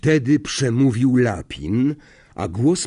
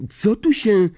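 high, loud question cut short; raised voice, breaking off; speaking up loudly; 10 semitones higher, 5 dB louder than usual. A narrator's reading. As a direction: blurt it out in alarm. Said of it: "powiedział głośno Harry".

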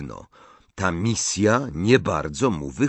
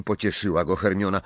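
usual speaking voice

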